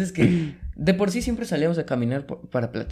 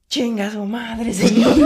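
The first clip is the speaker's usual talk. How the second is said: loud, slow, higher shouted curse breaking into a laugh; retold yelled insult; 8 semitones higher, 8 dB louder, much slower than usual. Friend cursing back at the neighbor: loud slow laughing yell. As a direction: yell it at the old man, half laughing. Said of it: "Entonces nada más gritó".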